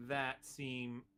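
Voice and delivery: ordinary talk, nothing marked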